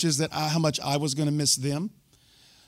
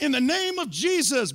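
bold prayer; 8 semitones higher than usual. Retold or imitated. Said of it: "I was like".

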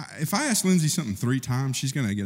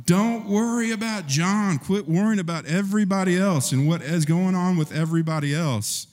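mild rebuke; 7 semitones higher than usual. Acted. Jesus rebuking Peter.

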